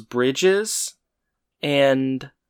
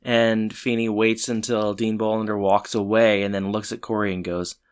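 ordinary talk

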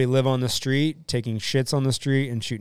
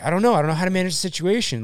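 flustered; 7 semitones higher, 5 dB louder, much faster than usual. Retold or imitated. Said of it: "so we're like".

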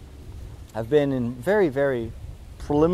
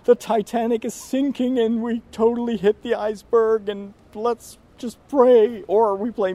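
doom-and-gloom colleague; 8 semitones higher, 6 dB louder than usual. Retold or imitated. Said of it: "in this mode of".

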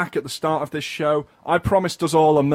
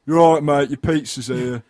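casual mock delivery-driver voice; pizza delivery guy; 6 dB louder than usual. Co-host showing how delivery men talk, matey driver voice. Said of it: "a way that they actually say it".

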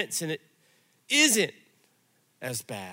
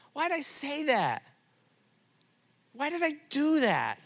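regretful self-reproach; 8 semitones higher than usual. Retold or imitated. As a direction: ask it as if replaying a mistake. Voicing regret that eats you.

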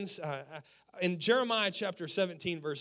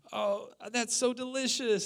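overdone delight; 7 semitones higher than usual. Retold or imitated. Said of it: "and he was like".

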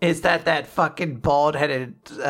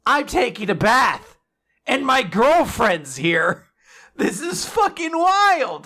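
higher, drawn-out mock amazement; mock overwhelmed; 7 semitones higher, slower than usual. Podcaster acting out a boy stunned in the bath, mock overwhelmed. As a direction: stretch it out, climbing high on the last phrase. Retold overwhelmed reaction of a character.

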